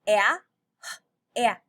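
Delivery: "a" and "At" said loud with a drawn short a; stretched a sound. Shown as a demonstration. Teacher isolating the short a for children. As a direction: lean hard on the a vowel. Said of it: "Do you hear the".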